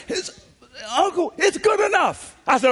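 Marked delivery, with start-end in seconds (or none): 0.9-2.3 put-on high, slow kid's plea; pleading child. Nephew pleading with his uncle that it's good enough. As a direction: drawl it like a kid begging to be let off.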